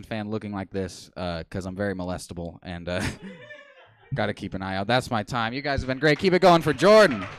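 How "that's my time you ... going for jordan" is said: louder and higher, rising to a call; host-style call-out; sign-off. Real, not acted. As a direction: hype the crowd to cheer for Jordan. Stand-up sign-off to the crowd.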